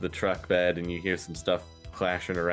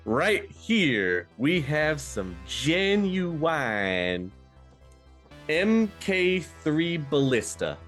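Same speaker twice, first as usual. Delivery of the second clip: drawn-out, swooping higher sales pitch; showy arms dealer; 7 semitones higher, much slower than usual. Acted. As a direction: present the goods like a showman, stretching the words. Arms dealer presenting his wares.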